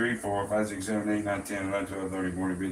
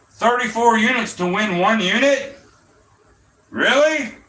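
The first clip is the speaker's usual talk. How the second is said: incredulous; 9 semitones higher, 3 dB louder than usual. Real, not acted. Mocking a 34-to-1 bet.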